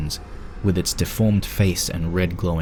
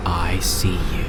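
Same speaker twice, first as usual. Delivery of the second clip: slow, breathy ghostly whisper; eerie whisper; slower than usual. Acted. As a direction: whisper it like a voice from the walls. Eerie message on the wall.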